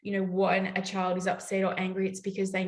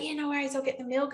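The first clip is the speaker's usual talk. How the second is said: breezy partner; 7 semitones higher, faster than usual. Retold or imitated. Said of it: "her partner's like".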